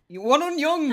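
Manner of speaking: loud high announcer call; excited shout. Acted. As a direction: belt out the show title. Host shouts show title.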